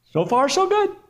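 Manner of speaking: high, rising falsetto; falling man's optimism. Acted. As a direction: chirp it mid-fall in a high voice. Falling man reports halfway down.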